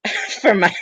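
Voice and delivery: breaking into giggles in character; laughing. Real, not acted. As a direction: keep cracking up mid-line. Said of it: "Take your time".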